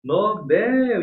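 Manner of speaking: "Nobel" high, as no-BÉL; stress on BEL. Shown as a demonstration. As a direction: lift the last syllable of "Nobel". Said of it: "É a última".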